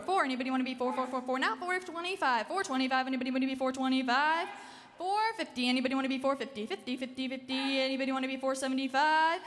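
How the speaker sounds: ordinary talk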